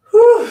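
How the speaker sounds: high, loud sigh of relief; relieved whew. Real, not acted. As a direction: release a big whew. Relief after the stretch.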